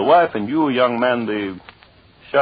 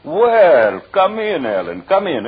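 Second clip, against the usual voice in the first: mock welcome; 8 semitones higher, slower than usual. Husband greets wife and lover.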